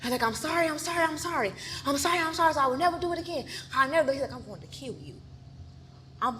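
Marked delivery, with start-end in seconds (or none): 0.2-4.1 high, repetitive begging; pleading apology. Retold or imitated. Retells begging her choking attacker. 4.3-5.2 very soft, menacing vow; hushed threat. Retold or imitated. Retells attacker's threat while choking.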